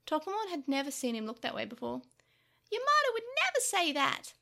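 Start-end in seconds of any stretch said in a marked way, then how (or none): 2.7-4.3 high, raised-voice insistence; urgent protest. A narrator's reading. Tokomon defends Yamato to an icy Takeru, urgent protest. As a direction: cry out to convince him.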